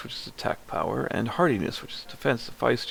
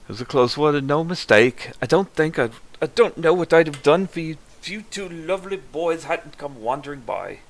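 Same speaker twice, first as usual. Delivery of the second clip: lively, lilting auntie; chatty village auntie, pitched up a little; auntie character voice; 7 semitones higher, 5 dB louder than usual. Acted. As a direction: play a grateful, talkative auntie. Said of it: "That's not what she sounded like at all".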